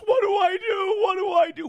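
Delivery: high panicky mock whine; panicked whine. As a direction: imitate someone frantic and panicking. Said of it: "Will's in people's D Ms".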